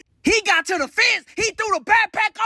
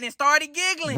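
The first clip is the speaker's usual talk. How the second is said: ordinary delivery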